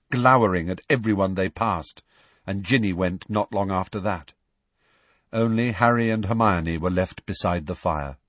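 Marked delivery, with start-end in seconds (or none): none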